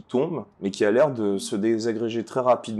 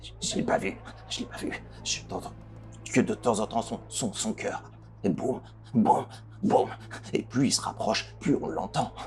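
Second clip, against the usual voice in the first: frightened whispering NPC; 5 semitones higher, 5 dB quieter than usual. Acted.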